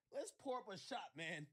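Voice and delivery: usual speaking voice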